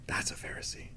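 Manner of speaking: hushed whisper on "That's a Pharisee". Shown as a demonstration. Preacher whispers the point to the congregation after a long pause. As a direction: let it sink in with a bare whisper.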